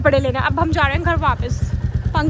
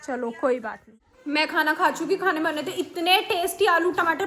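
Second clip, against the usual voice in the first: normal talking voice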